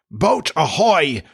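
long, high ringing hail; drawn-out pirate captain's call over water; Hook. A narrator's reading. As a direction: hail the boat, stretching each word. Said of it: "rang over the lagoon in Hook's voice".

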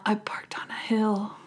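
whispered horror at being caught; a soft, unvoiced gasp of guilt; whispered realization. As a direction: breathe it out in dawning shock. Imitating Linda's shocked realization.

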